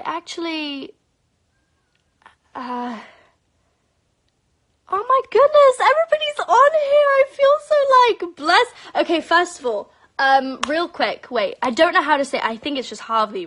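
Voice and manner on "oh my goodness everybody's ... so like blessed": much higher and louder, gushing; overjoyed at how many viewers joined; excited squeal. Real, not acted. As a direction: gush with delight.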